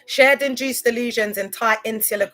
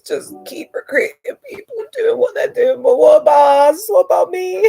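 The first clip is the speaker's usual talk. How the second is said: rising into a loud, high, raw cry of grievance; building from bitter to a loud anguished plea; anguished outburst; 6 semitones higher, 8 dB louder than usual. Real, not acted. Outburst against cult leaders.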